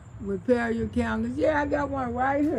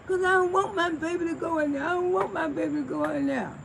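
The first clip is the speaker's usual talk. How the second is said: mock crying daddy; 6 semitones higher than usual. Daddy voiced crying again.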